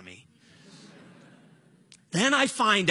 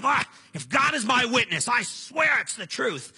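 raised-voice, super-serious protest, acted; flustered insistence; 6 semitones higher, 4 dB louder than usual. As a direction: imitate a flustered buddy, higher and louder. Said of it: "he would get so flustered".

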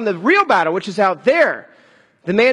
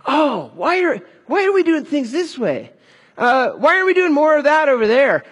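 high-pitched mock-outraged complainer; hammed-up griping, pitched well up; armchair critic; 4 semitones higher than usual. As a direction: whine in a raised voice like an indignant critic. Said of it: "would be an armchair critic".

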